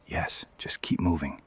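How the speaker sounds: low urgent whisper; barely voiced, pushing them on quietly. Leading the group past searchers, urgent whisper.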